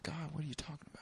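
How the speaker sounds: very soft, puzzled protest; hushed mock confusion; baffled denial. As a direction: quietly play dumb with God. Acting his baffled reply to God.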